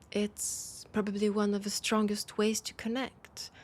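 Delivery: ordinary delivery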